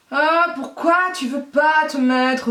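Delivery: high, slow, drawn-out moan; pleading whine. Real, not acted. Vlogger begging the lash to stay on, high whine. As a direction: plead with it, stretching every word.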